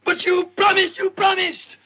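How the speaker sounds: put-on high, loud pleading like a spoilt child; whiny childish protest. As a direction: wail it like a sulking boy.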